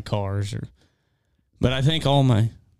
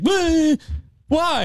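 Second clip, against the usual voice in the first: son's outraged whine. Retold or imitated. Son to dad over duck hunting, indignant high squeal. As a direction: mimic the kid's shocked protest. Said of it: "And he's like".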